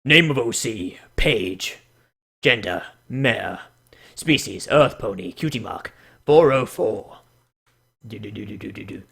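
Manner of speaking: low, soft dramatic reading voice. Pretend. Reading the OC profile in a mock dramatic voice. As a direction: read it like a grave trailer. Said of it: "I need a more dramatic voice".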